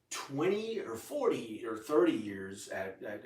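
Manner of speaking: ordinary talk, nothing marked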